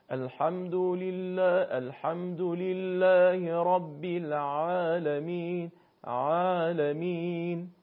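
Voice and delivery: slow chant, ع in عالمین drawn from mid-throat; stretched throaty ʿayn. Shown as a demonstration. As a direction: lengthen عالمین with a light pull in the throat.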